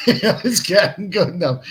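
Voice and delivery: slow words spoken through real laughter; amused laughing aside. Real, not acted. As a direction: chuckle it out, loving the chat. Laughing at the chat comments.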